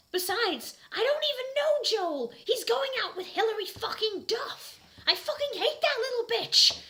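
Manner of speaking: high, loud sneering rant; disgusted. Acted. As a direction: spit out contempt for her. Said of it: "I said disgustedly".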